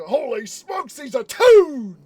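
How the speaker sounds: loud, high shout building to the last word; mock astonishment. Retelling the shocked discovery of a toon, hammed-up astonished shout. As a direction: exclaim it like a stunned cartoon witness. Acts out startled line from film.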